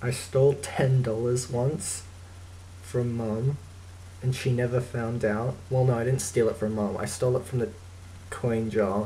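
normal talking voice

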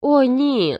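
high, loud gasping cry; girl's alarmed cry; frightened cry. A narrator's reading. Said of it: "зойкнула дівчина".

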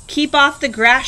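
mild storybook shout. A narrator's reading. Hank warning the cowboy off, mild shouting. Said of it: "shouted Hank".

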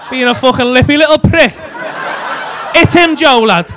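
loud, high, laughing jeers; laughing mock yell. Egging Joe on to hit his mate, loud laughing yells. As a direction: hype up a play fight, shouting through laughter.

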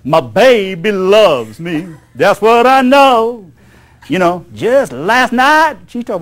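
put-on "My baby loves me, that's what I know" in a careless drawl, "baby" loose and flippant; brash drawl. Shown as a demonstration. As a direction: sing it with a brash, bug-off drawl.

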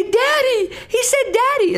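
delighted parent. Retold or imitated.

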